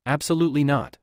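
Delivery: ordinary delivery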